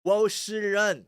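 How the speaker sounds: slow American-accented Mandarin; John Cena. Shown as a demonstration. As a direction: imitate an American labouring through Mandarin. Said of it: "Like John Cena".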